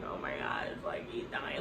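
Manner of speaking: hushed, unvoiced whisper of grief; retold daughter whispering over her dying father; tearful whisper. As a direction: breathe it out like she's crying over her dad. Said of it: "she's, like, crying over her dad".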